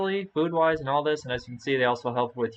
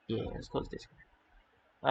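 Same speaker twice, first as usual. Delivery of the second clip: whispering; 11 dB quieter, much slower than usual. Real, not acted. Muttered to self.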